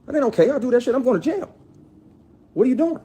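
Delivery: high, loud reenacted confrontation; retold angry check. Reenacting checking the actress who groped him, high outraged. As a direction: snap back at her, outraged. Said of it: "checked her, like".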